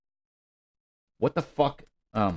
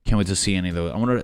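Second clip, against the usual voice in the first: normal talking voice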